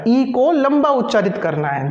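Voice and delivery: ordinary talk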